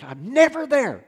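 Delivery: high, loud, slow mock Fritz; imitating Fritz. Pastor retelling what Fritz told him, in a loud, high mock voice. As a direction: stretch out his complaint like a put-upon friend. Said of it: "and Fritz goes".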